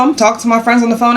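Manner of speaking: ordinary delivery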